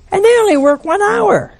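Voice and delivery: high, indignant whine of a worker; a hint of a bitter worker; mock grumbling complaint. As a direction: whine it like a sore laborer. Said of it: "They grumbled".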